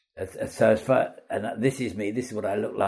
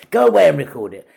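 loud, high dismissive order; imitating an exasperated director's command; fed-up director; 6 semitones higher, 10 dB louder, faster than usual. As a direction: send the actor packing. Director sends him off, fed up.

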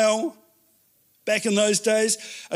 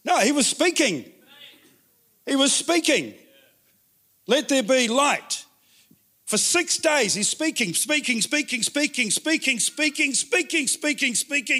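rising excitement; 7 semitones higher than usual. Acted. Preacher builds on God speaking.

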